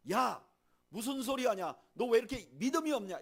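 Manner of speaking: loud, high scolding of a faithless prophet; scolding rebuke. Pretend. God imagined scolding Elijah, loud and angry. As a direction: bark at him for his lack of faith. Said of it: "이렇게 말하지 않아요".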